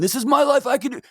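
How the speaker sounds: high-pitched mock indignation; defensive protest.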